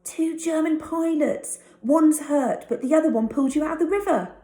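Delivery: high and loud, the last line rushed fast; eager boy. Acted. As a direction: rattle it off in a hurry. Tucky keeps explaining eagerly.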